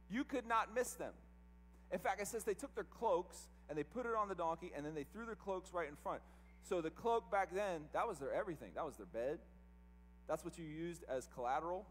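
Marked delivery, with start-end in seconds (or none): none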